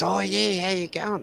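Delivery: high, chirpy imitation of a local bloke; imitating the local. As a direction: lightly play the friendly local. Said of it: "He goes".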